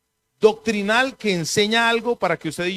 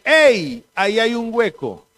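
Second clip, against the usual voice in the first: loud, higher warning call; warning shout; 5 semitones higher, 4 dB louder, slower than usual. Retold or imitated. Man behind warns walker of a hole, shouting. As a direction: shout to stop someone from falling. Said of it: "le grita y le dice".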